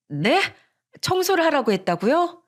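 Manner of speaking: taken aback, voice lifting high; disbelieving. A narrator's reading. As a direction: echo it back in disbelief. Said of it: "형님의 말이 믿기지 않았어요".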